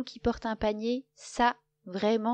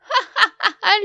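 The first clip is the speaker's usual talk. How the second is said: loud high burst of laughter; 13 semitones higher, 15 dB louder than usual. A narrator's reading. Lapin laughing at Loup, loud high laugh. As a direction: laugh so hard you fall over. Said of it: "Lapin se met à rire".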